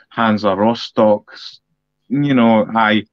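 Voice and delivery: ordinary talk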